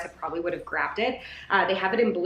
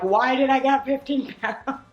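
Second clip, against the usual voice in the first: mock dismay; 6 semitones higher, 4 dB louder than usual. Real, not acted. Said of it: "now I'm holding it and being like".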